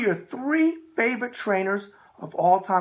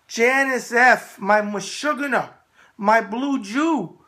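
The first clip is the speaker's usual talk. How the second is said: slow, loud name call; 4 semitones higher, 4 dB louder, much slower than usual. Acted. Host drawing out a fan's name, slow and loud. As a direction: stretch out Janice F slowly and loudly, teasing her. Calls her name back, drawn out.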